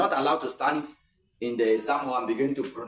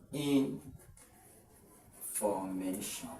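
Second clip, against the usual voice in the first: slow, quiet, spelled-out "information"; teacher reading the option slowly; slow drawn-out read; 5 semitones lower, 8 dB quieter, much slower than usual. Shown as a demonstration. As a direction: draw "information" out slowly syllable by syllable. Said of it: "you stress such words at the second syllable".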